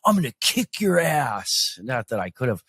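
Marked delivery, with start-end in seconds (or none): 0.0-1.5 high, whimpering mock-tough threat; tearful threat. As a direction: imitate a pipsqueak standing up through tears. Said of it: "you know, tears running".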